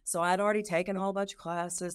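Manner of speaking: ordinary talk, nothing marked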